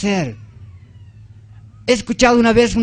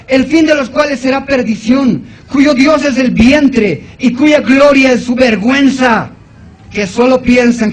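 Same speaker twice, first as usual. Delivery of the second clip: loud, higher-pitched preacher declamation; forceful scripture reading; 4 semitones higher, 5 dB louder than usual. Acted. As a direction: proclaim the verse in one driving run. Rereads verse at full force.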